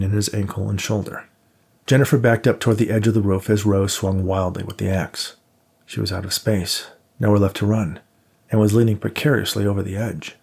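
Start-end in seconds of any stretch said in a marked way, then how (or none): none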